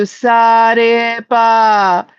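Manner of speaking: "sarepa" drawn out slowly, syllable by syllable: sa-re-pa; sounding out a word. Shown as a demonstration. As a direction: spell it out by ear, very slowly, "sarepa". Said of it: "and I say that to you".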